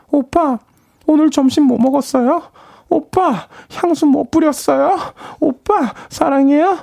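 very high, eager fan voice; excited fangirl. Acted. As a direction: gush at the idol.

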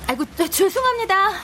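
flustered apology. Acted.

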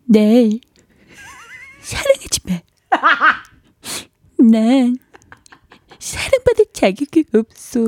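put-on stilted, high old movie melodrama; bad melodrama acting. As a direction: ham up a stilted tragic farewell. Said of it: "발연기 하는, 어, 모습을 한번 모사해 본다고".

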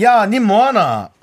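slow, drawled Gangwon dialect 야, 니 뭐하나; lightly voiced Gangwon country boy. Host plays childhood friend in Gangwon dialect. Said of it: "그 당시 말로".

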